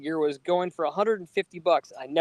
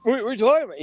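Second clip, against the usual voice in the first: confused uncle; 8 semitones higher, faster than usual. Retold or imitated. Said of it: "And he went".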